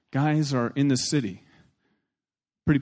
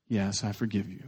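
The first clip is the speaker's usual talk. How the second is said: low, soft reluctant-sounding reply; quiet forgiveness; 7 semitones lower, 9 dB quieter than usual. Retold or imitated. Brother has to say it, soft and low. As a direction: drop low and soft for the answer.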